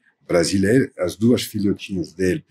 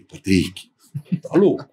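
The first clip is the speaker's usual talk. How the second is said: mock disbelief, pitched up; incredulous retort; 3 semitones lower than usual. Narrator answering his friend's modelling idea, incredulous retort. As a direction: throw back a disbelieving retort.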